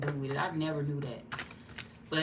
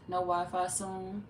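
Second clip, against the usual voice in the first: normal talking voice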